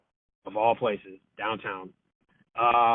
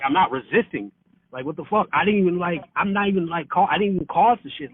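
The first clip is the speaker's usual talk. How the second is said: raised, heated protest, pitch climbing; indignant, voice rising and louder; retold angry protest; 6 semitones higher, 6 dB louder than usual. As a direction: push back hard against the cops. Arguing with cops while detained.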